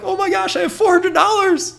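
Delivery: very high, loud mock-ecstatic cry; ecstatic squeal. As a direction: squeal it like an overjoyed kid. Acts out joy at first payment.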